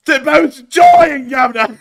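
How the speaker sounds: high, very loud geezer yell; Brexit geezer marmot. Pretend. Marmot contradicting the denial to Governor, shouting geezer. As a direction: shriek it like a cartoon Brexit geezer. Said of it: "the accent of the marmot is that of a Brexit geezer".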